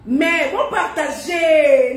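loud, slow urging; insistent. Real, not acted. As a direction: press them to share. Urging viewers to share. (appel fort et lent)

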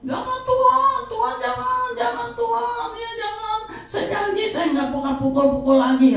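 high, wailing plea to God; mother crying. Retold or imitated. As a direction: beg God tearfully like a panicked mother. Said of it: "Malah ibunya yang nangis". (ibu menangis memohon kepada Tuhan dengan suara tinggi)

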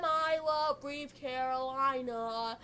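slow, drawn-out declaration. Real, not acted. Fan professing love for [name], slow and drawn out. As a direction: savour every word. Repeats band love line.

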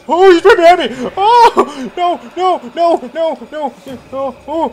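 high loud panicked no's; panicked screaming. Real, not acted. Gamer fleeing the monster, panicked screaming. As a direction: scream as it catches you.

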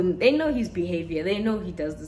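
ordinary delivery

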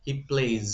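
"plays" ending in a buzzing z; z sound at the end. Shown as a demonstration. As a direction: close "plays" on a voiced z. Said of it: "এটা হচ্ছে প্লেজ". (শেষে জ ধ্বনি, প্লেজ)

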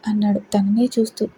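ordinary delivery

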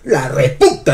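"reputa" with a punched U; "reputa" stressed heavily on the "pu"; stress on reputa's U. Shown as a demonstration. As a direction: hit the middle syllable of "reputa" hard. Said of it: "con tilde en la U".

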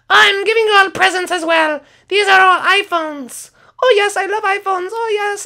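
ordinary delivery

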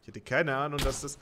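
ordinary talk, nothing marked

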